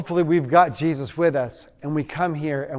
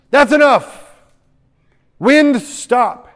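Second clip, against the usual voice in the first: raised, forceful order; loud command; 6 semitones higher, 5 dB louder, slower than usual. Acted. Preacher mimicking an order to the storm, commanding. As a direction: order it to halt at once. Mock command to wind and waves.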